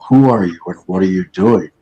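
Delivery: ordinary talk, nothing marked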